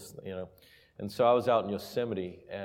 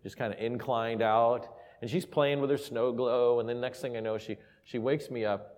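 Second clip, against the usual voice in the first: ordinary talk, nothing marked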